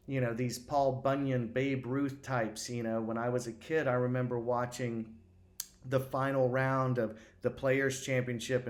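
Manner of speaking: normal talking voice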